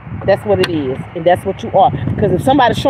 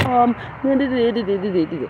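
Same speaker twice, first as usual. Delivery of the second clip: mock hesitation; 5 dB quieter than usual. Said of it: "I'm not stuttering or hesitating".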